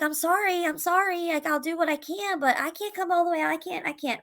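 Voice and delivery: mom's apology. Retold or imitated. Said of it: "she was like".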